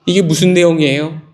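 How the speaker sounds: usual speaking voice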